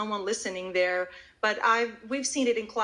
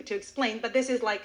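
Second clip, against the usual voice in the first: normal talking voice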